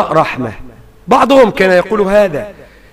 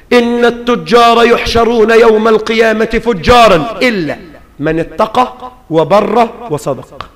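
ordinary delivery

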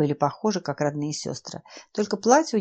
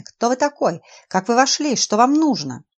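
loud, higher, demanding questions; alarmed shouting; 6 semitones higher, 7 dB louder than usual. Acted. Professor confronts intruder.

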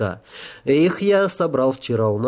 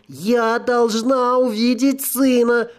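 higher, slow, near tears; sobbing ghost voice; 10 semitones higher, slower than usual. Acted. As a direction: cry out desperately, about to sob. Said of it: "Призрак вдруг всхлипнул".

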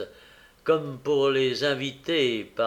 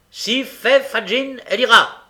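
high, very loud bark; shouted insistence; 9 semitones higher, 11 dB louder than usual. A narrator's reading. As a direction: shout down her refusal. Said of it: "s'écria Fax".

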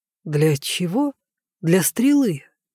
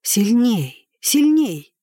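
urging to himself; 5 semitones higher, much slower than usual. A narrator's reading. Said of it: "говорил он про себя".

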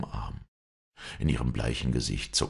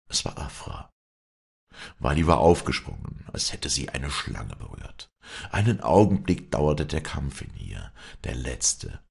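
plain reading voice

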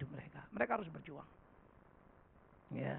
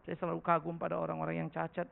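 ordinary talk, nothing marked

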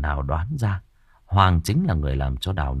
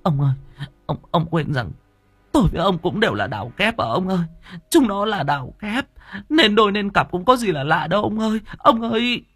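high-pitched, sniffling wife's appeal; tearful pleading; 12 semitones higher than usual. A narrator's reading. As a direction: plead on the verge of tears, voice climbing high. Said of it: "Bà Hỏa rưng rưng sụt sùi". (rưng rưng sụt sùi van nài)

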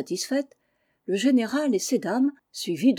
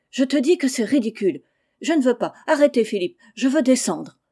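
indignant protest; 4 semitones higher, 6 dB louder than usual. Acted. Félicie quarrels, demands to get down.

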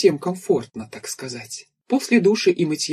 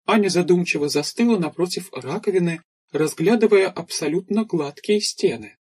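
usual speaking voice

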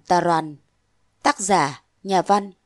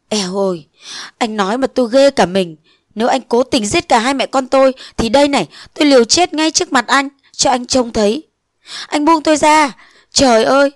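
higher and louder, near a scream; desperate cry; 4 semitones higher, 4 dB louder than usual. Acted. Nguyệt threatening suicide before Phong, desperate cry. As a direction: cry out in despair.

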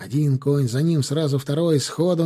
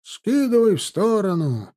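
high coachman's yell; 7 semitones higher than usual. A narrator's reading. Coachman at a blocked road, impatient yell. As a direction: holler from the coach box. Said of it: "орал с козел кареты".

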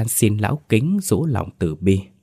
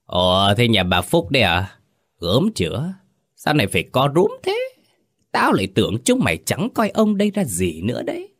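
contemptuous, rising much higher to a mocking squeal; sneering; 3 semitones higher than usual. Village chief mocking the old woman, higher and sneering. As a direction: sneer down at the cringing peasant. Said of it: "giọng khinh khỉnh".

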